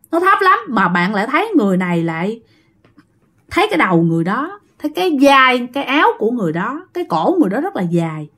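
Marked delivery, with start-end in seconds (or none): none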